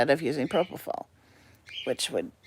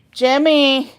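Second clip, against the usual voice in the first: calling out; 9 semitones higher, 10 dB louder than usual. Real, not acted. Calling out to the pet bird.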